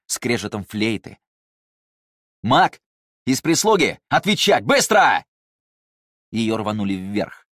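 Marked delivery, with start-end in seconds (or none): 2.4-5.3 barked orders. Acted. Soldier barks interrogation orders.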